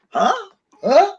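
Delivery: high, questioning mock-baffled grunts; mock bafflement. Mother hearing her daughter's French, acted bafflement. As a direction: play totally lost, pitching it up.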